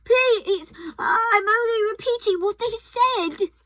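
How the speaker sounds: stammering. A narrator's reading. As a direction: stammer it out in fear. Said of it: "stammered Edmund".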